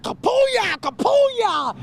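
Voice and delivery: shouting. Retold or imitated. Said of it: "He said".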